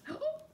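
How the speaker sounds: high playful gasp of surprise; hammed-up delighted gasp; mock gasp. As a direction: gasp as if amazed for the kids. Teacher reacting to page turn.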